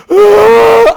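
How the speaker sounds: grito agudo y fuerte de miedo; agudo grito de susto. Retold or imitated. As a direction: suelta un chillido asustado. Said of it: "pero sonó como así". (chillido de susto muy agudo)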